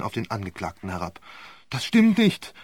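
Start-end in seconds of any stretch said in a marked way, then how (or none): 1.7-2.5 high, loud, pleading; panicked plea; terrified accused man; desperate denial. A narrator's reading. Defendant breaking down.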